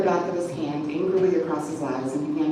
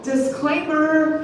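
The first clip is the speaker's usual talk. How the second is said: long, very slow sing-song, higher and louder; sing-song shout; 9 semitones higher, 5 dB louder, much slower than usual. A narrator's reading. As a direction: stretch it out like a taunting tune. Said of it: "he shouted, in a long, drawn-out, sing-song tone".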